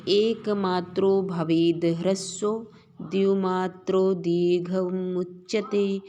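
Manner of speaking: slow, loud measured chanting of the verse; verse recitation. Shown as a demonstration. Teacher reciting a Sanskrit verse to students, slow and loud. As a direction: intone each half-line deliberately.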